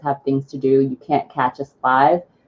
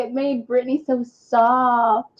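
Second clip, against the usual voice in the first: gushing; 10 semitones higher, slower than usual. Retold or imitated. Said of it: "But I was like".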